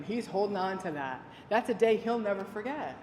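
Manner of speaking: ordinary talk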